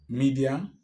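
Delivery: ordinary talk, nothing marked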